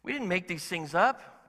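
ordinary delivery

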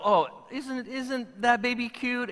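mock doting coo over a cute baby; gushing over a baby. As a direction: coo at the newborn like a doting relative. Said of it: "sometimes we just go".

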